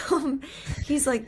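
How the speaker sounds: ordinary talk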